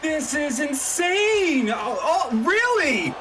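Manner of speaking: high, slow, laughing disbelief; drawn-out amazed reaction. Real, not acted. Reacting to the surprise team.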